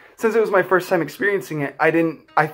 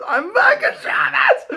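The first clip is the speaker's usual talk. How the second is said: joyful shout; slower than usual. Retold or imitated. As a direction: shout it out with pure relief at being back.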